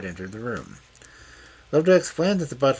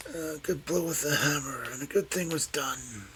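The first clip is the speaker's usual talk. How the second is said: higher-pitched, whispery murmur; slow and distinct; 7 semitones higher than usual. A narrator's reading. Muttering old man recalling the hammer, slowly and distinctly. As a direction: let it fade into a whisper. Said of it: "slowly, and distinctly, he said".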